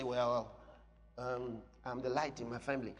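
low, hesitant, unambitious claim; timid hedging. Acted. Believer backing off a claim that sounds big, timid and hedging. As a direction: shrink from the big words in a low mumble. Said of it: "Now, that sounds big. So you say".

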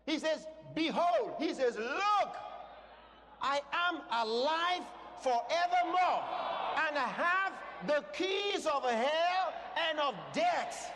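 high, loud, ringing preacher's proclamation; full-force preaching. Real, not acted. Preacher proclaiming scripture to the congregation, full-force preaching. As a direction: proclaim it slowly, drawing out the big words.

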